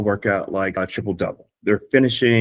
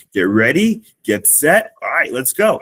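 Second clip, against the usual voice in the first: rising higher and louder into the start; building hype; 6 semitones higher, 4 dB louder than usual. Shown as a demonstration. Coach launching the class into the last lap, building louder and higher. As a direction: build the energy back up. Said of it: "so I can build it back up".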